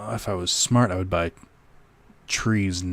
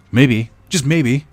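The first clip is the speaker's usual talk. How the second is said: teasing maybe; 6 semitones higher, 11 dB louder, much slower than usual. Acted. Teasing chat about Thanksgiving.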